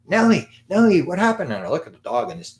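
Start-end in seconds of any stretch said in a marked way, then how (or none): 0.1-1.5 calling the dog in a sudden high, loud alarm; alarmed yell. Retold or imitated. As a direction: shout her name like the blood just started. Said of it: "I hear Chris yell out".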